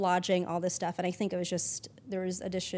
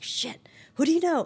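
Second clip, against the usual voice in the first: higher, louder retold scramble for help; mock urgent plea; 9 semitones higher, 6 dB louder than usual. Crew hunter asking around when everyone's booked, mock urgent. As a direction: act out a urgent ask, raised in pitch and volume.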